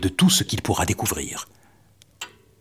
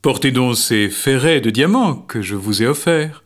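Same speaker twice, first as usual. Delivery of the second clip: king voice; 3 semitones higher than usual. Acted. King's demand.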